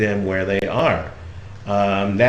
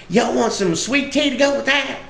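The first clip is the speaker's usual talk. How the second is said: very high Southern granny voice; Southern old lady; 14 semitones higher than usual. Retold or imitated. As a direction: offer it high-pitched, like a country hostess. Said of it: "sweet little old lady".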